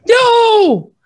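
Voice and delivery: a huge high shouted no; crowd shout. Retold or imitated. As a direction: yell it as a whole square. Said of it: "hundreds of thousands of people yelled".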